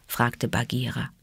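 plain reading voice

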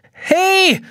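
loud, high yell; calling out. A narrator's reading. Trapped boy shouts up at the glass prison. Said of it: "ruft Jonas".

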